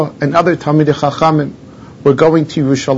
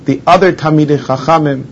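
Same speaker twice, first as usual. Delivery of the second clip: normal talking voice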